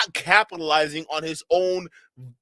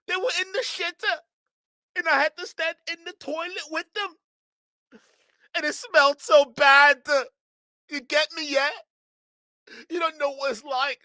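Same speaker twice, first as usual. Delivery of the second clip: high-pitched mock sob story; mock whining; 9 semitones higher than usual. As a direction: whine it way up high, hammed-up. Mocking a complaining streamer's sob story.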